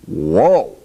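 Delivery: loud, swooping whoa; startled comic outburst; performed whoa. Acted. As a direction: exclaim it big, pitch leaping up. Recorded exclamation in dubbing session.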